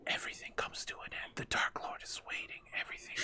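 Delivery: very soft, voiceless, sinister whisper; ominous whisper. Acted. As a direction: whisper it like a haunted book. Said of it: "the whispers get kind of loud".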